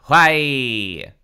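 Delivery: loud, long falling wail; hammed-up wailed question. A narrator's reading. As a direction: stretch it into an anguished cry. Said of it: "Dina wailed".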